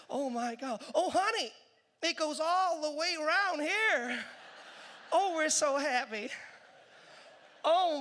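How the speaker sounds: put-on overjoyed tourists in a queue, American accent; hammed-up American delight; gushing Americans. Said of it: "the Americans say".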